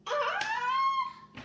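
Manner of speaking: high piercing mock scream; shriek. Gerald crying out at the story's end, a high mock shriek. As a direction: let out a hammed-up shriek. Dramatic cry ending the story.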